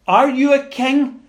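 a hint of a doubting Roman governor; Pilate's challenge. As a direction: toss out the question like a sceptical governor. Preacher voicing Pilate's question.

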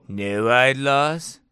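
slow stoner drawl, rising at the end. Acted. Distracted by the Noahide laws, stoner drawl. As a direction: stretch it out like a dazed stoner. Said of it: "I know I sound like a stoner".

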